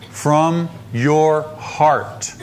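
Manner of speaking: "From your heart" very slow, each word apart; weighty, spaced-out "From your heart"; deliberate emphasis. Shown as a demonstration. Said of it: "What are the next three words?".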